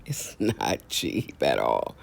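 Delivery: chuckling through the words, low and slow; laughing. Real, not acted. Joking to viewers about pricey oil changes, laughing. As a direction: laugh it off, slowly.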